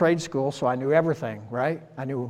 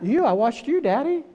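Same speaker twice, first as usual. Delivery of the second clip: put-on higher, small child piping up; a little kid telling Daddy he copied him; child's voice; 9 semitones higher than usual. Child answers parent, imitating.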